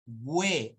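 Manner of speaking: "we" with the U heard as W; emphatic, slow we with rounded glide; U sounded. Shown as a demonstration. Teacher showing students where U sounds, demonstrating. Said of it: "ahí suena la U".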